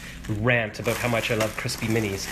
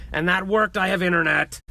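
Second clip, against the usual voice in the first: excited; 6 semitones higher, 4 dB louder than usual. Real, not acted. Internet finally working.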